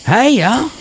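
very high country holler, imitated; high, hammed-up Southern greeting; put-on country accent. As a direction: mimic a friend's dad laying the country accent on. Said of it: "He puts it on too".

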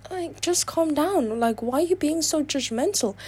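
high-pitched, exasperated complaint; exasperated pleading. Real, not acted. Telling judgmental people to calm down, exasperated. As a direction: plead with the critics, pitch lifted.

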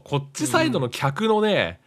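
ordinary talk

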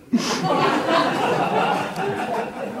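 high airy giggle; wheezy high laugh; breathless laugh. Real, not acted. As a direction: let a real laugh escape in breath. Laughing after Mikey's joke.

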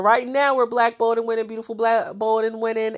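ordinary talk

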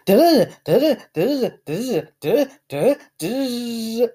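mock nonstop chatter; high mocking babble imitating someone who never pauses; mocking rapid babble. Shown as a demonstration. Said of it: "That's how you talk".

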